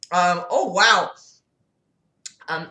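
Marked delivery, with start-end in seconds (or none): none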